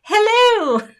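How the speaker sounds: high, loud, fluty British "hello"; over-the-top British nanny hello; Mrs. Doubtfire. Acted. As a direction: trill a hammed-up British greeting. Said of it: "that sounds like Missus Doubtfire".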